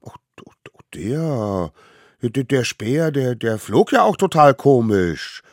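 halting start, then high protest; stammering excuse. A narrator's reading. Smallest savage explains the spear, stammering defensively. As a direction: fumble for words like a caught child.

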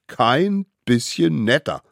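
mildly gleeful, measured echo of the rule; enthusiastic echo. A narrator's reading. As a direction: relish "Kein bisschen netter" without shouting. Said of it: "riefen die kleinen Wilden begeistert".

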